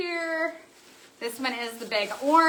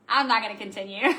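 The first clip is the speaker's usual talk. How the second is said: normal talking voice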